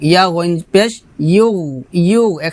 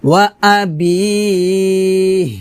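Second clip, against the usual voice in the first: melodic, drawn-out tajweed chant; Quran recitation; 3 semitones higher, much slower than usual. Shown as a demonstration. Teacher chants the verse for students to copy. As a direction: sing the verse slowly.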